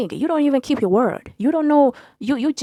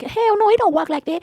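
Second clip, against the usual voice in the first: mock ex voice; 11 semitones higher than usual. Boyfriend denying it to her face, in a high put-on voice. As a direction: put on a high, offended ex's voice. Voicing ex arguing.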